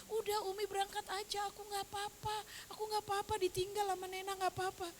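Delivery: put-on high and very soft, as a crying child; hushed, high-pitched imitation of a brave child in tears; imitating tearful grandchild. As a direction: retell it in the grandchild's small tearful voice. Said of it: "tapi sambil nangis gitu".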